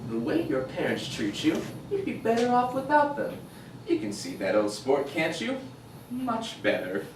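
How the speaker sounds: low, soft quoted persuasion; hushed, low-pitched sales pitch; recruiter's pitch. Acted. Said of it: "this guy, he says".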